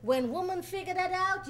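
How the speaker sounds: ordinary delivery, nothing marked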